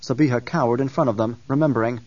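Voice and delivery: plain reading voice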